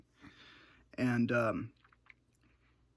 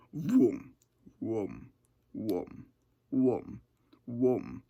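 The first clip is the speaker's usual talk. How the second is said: imitated whooming pulse; much slower than usual. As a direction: hum the throbbing pressure of the room. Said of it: "intensity that's at its maximum".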